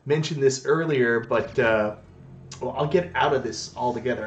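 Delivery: normal talking voice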